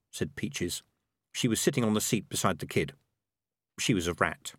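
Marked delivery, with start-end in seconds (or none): none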